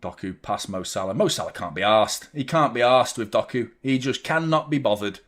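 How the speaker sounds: ordinary talk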